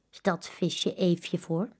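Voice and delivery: usual speaking voice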